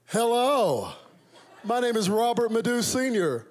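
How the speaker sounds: high, loud polished American hello; American accent. Pretend. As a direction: announce yourself proudly in an American accent. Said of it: "it did not go down like that".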